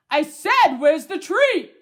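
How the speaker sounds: very loud, insistent bark of words; barking demand. A narrator's reading. Dog Lance wants his treat from his human, barking. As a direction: demand it at full voice like an impatient dog. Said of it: "Lance is barking".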